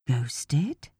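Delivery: a breathless, voiceless whisper of surprise; whispered "Ghosted?" in shock; whispered disbelief. A narrator's reading. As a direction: hush it out, stunned. Friend repeats news in disbelief.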